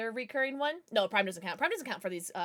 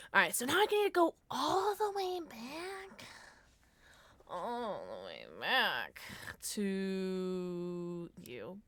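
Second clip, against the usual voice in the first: silly game voice; 2 semitones lower, 4 dB quieter, slower than usual. Pretend. Game character voice, far timbre.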